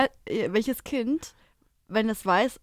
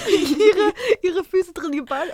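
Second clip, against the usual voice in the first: laughing; 7 semitones higher, 6 dB louder, slower than usual. Real, not acted. Lacht beim Nachfragen.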